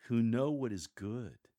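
ordinary talk

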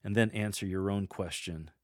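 ordinary talk, nothing marked

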